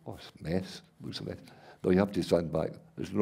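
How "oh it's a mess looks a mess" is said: softly breathed, as if peering close; a hushed let-down aside; mock whispered dismay. Viewer up close to the painting, hushed disappointment.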